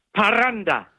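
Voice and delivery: "parranda" with a long trilled rr, slow; rolled R demo. Shown as a demonstration. [name] demuestra cómo pronuncia la R española. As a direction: roll the rr in "parranda" hard. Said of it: "todavía no me sale muy bien".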